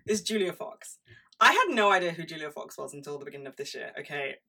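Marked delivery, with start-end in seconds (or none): none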